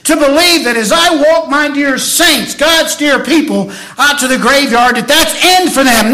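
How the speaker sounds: rising, loud preacher's cry; fervent graveside sermon cry; preaching at full force. Acted. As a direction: preach it out over the congregation.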